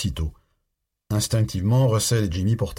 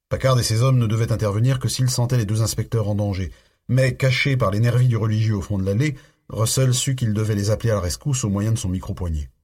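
ordinary delivery

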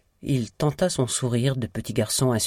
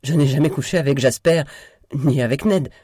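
loud, higher-pitched protest; indignant denial; 4 semitones higher, 7 dB louder than usual. A narrator's reading. Sister wrongly thinks herself accused, loud indignant denial. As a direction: deny it forcefully at full voice. Olga hotly denies the affair.

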